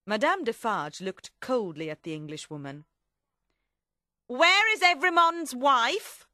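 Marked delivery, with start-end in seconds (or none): 4.3-6.3 high, loud, slow demand; raised imperious question. Acted. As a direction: demand it forcefully, drawing out each word. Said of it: "she demanded".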